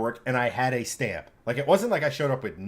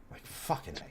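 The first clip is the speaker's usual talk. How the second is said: whispered curse under the breath; a hushed, exasperated aside; breathy mutter; 13 dB quieter than usual. Real, not acted. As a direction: mutter it almost voiceless, fed up. Fed up with the DMV.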